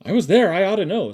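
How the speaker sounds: loud, high insistence; Caleb. A narrator's reading. Caleb arguing back at his sister, insistent. Children quarrelling over the whinny.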